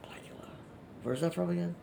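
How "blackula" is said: whisper. Real, not acted. Repeating the word under breath.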